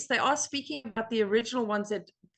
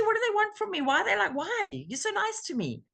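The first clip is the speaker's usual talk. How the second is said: high-pitched, comically baffled questioning; mock bewilderment; 10 semitones higher than usual. Newcomer questioning why the group is so nice, high mock bewilderment. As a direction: act out suspicion in a high, swooping voice. Acting out early baffled thoughts.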